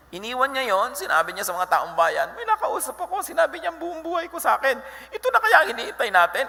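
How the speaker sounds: high, laughing, breathless excitement; excited. Retold or imitated. Woman telling the townsfolk, high and excited. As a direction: rush it out, high and thrilled.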